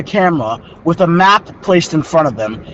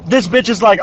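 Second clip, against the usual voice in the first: ordinary talk, nothing marked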